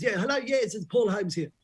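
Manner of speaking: high, brisk phone caller; Paul Holmes. Retold or imitated. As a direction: announce yourself high on the phone. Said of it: "this voice goes".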